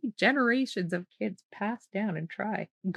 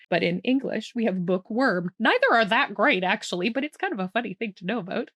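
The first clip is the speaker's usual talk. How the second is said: usual speaking voice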